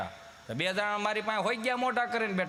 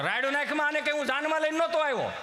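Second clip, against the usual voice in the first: husband's retort; 8 semitones higher than usual. Retold or imitated.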